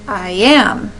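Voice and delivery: "I am" light on I, stressed on AM; stressed second syllable. Shown as a demonstration. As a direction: lean on "am" in "I am". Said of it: "is how that stressing would sound".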